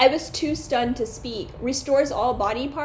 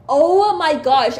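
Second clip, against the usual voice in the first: loud, slow drawn-out shock; stunned exclaim; 4 semitones higher, 9 dB louder, slower than usual. Real, not acted. Player reacting to cutting off a head, slow loud shock. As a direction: let the shock stretch out.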